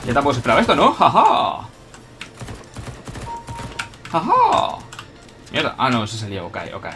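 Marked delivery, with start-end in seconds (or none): 0.9-4.8 loud, high gloating "ha-ha"; triumphant "ha-ha". Real, not acted. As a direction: crow in triumph over a beaten rival. Gloating after catching an enemy. («ja, ja» triunfal y agudo)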